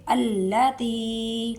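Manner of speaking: التی very slow with fatha on alif; demo pronunciation. Shown as a demonstration. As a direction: stretch التی starting with a. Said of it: "زبر ہم next word کے پہلے حروف پر". (التی زبر کے ساتھ آہستہ)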